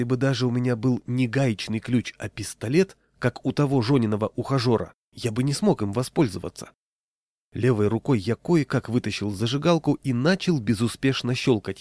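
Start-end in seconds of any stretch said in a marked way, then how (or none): none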